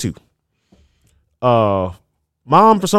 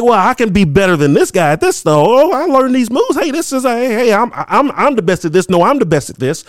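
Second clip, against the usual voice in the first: high, cocky gamer bragging; boastful excitement; 7 semitones higher than usual. Retold or imitated. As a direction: brag like a hyped arcade kid. Acted arcade player bragging.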